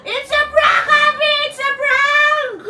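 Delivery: spring the surprise with glee; triumphant prank reveal; excited reveal. Real, not acted.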